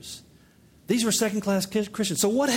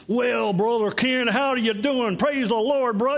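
slow, drawn-out Mississippi drawl, pitched up; higher, stretched-out mock Mississippi greeting; Mississippi accent; 4 semitones higher, slower than usual. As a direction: imitate a hearty Mississippi friend with a heavy drawl. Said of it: "a, a Mississippi accent".

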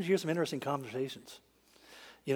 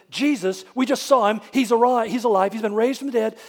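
louder, excited telling; breathless news; 5 semitones higher, 9 dB louder than usual. Retold or imitated. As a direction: burst in with the news. Excited women report resurrection.

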